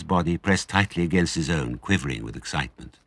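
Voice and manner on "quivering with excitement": usual speaking voice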